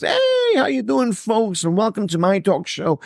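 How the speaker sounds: hammed-up showbiz welcome, pitch way up; cheesy talk show host. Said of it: "I'm not the guy who goes".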